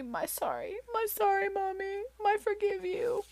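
put-on soft, high little-girl echo; lightly voiced toddler. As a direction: gently mimic the sweet toddler. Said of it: "she goes".

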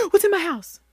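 sharp gasp then a high, startled cry; panicked gasp. Retold or imitated. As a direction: jump in fright at something moving. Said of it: "my reaction was panic".

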